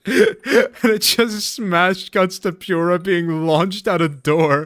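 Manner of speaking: high, loud, slow laughing retelling. Real, not acted. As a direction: tell it while cracking up.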